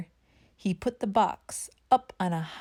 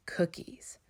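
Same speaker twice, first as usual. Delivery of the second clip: softly whispered title; 8 dB quieter than usual. A narrator's reading. Narrator teases the chapter title, whispered. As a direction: breathe the word like a secret. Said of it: "chapter title whispered".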